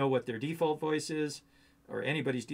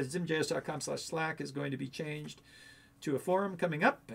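usual speaking voice

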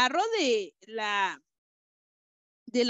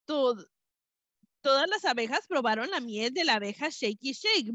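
usual speaking voice

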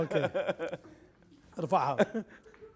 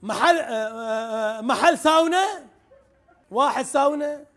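loud, unaware of own volume; too loud, headphones on; 5 semitones higher, 5 dB louder than usual. Real, not acted. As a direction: shout over music in your ears. Guesser now wearing headphones.

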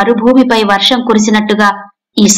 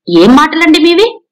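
indignant outburst; 6 semitones higher than usual. A narrator's reading. Indignant mother-in-law.

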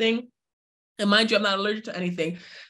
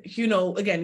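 ordinary delivery

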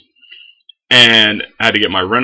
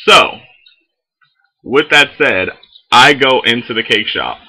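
normal talking voice

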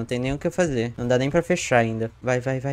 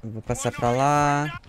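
usual speaking voice

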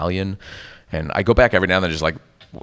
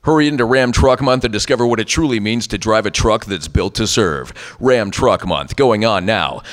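brisk announcer selling trucks; radio ad read; 3 semitones higher than usual. Shown as a demonstration. Voice actor demonstrates a dealership commercial, radio ad read. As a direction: sell it like a radio truck spot. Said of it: "the radio ones are always the worst".

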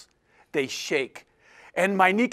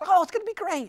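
much higher, breezy reassurance; breezy student; 13 semitones higher, faster than usual. Retold or imitated. Short student cheerfully reassuring the nervous climber, much higher. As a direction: toss it off brightly, no worry at all.

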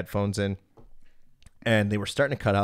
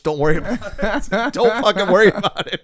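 higher, louder, laughing through it; laughing gloat; 12 semitones higher, 11 dB louder than usual. Retold or imitated. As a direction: crack up while brushing off the worry. Friend laughing while gloating.

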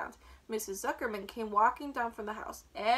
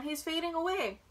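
mild storybook scream; 6 semitones higher than usual. A narrator's reading. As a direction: pitch it up in distress. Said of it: "screamed Fern".

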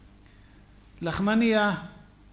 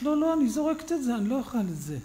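woman's voice; 6 semitones higher, 4 dB louder, faster than usual. Retold or imitated.

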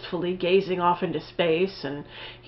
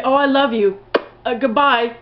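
higher, sing-song mock of the sappy couple; a lightly hammed-up parody of endless I-love-yous; teasing the couple's constant sweet talk, higher and louder; mock lovey-dovey; 5 semitones higher, 3 dB louder than usual. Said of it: "sum up this movie with one gesture or one sound".

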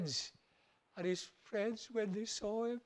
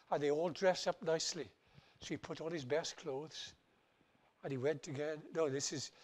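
usual speaking voice

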